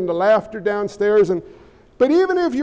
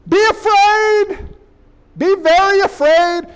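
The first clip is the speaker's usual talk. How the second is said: high, slow ominous warning; mock-ominous high warning; dramatic warning; 7 semitones higher, 3 dB louder, slower than usual. Real, not acted. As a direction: warn them like a horror trailer.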